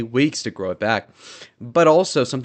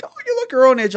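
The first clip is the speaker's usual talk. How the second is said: high mocking girl voice, much higher; mock college girl; 5 dB louder, faster than usual. Imitating college girls reassuring him, high mock voice. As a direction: chirp it like a mocked college girl. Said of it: "they'll be like".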